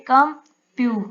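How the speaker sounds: "कम प्यू" as separate beats with a pause between; divided into syllables. Shown as a demonstration. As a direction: set "कम" apart from "प्यू". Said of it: "syllable division होगी इससे". ("कम", फिर रुककर "प्यू")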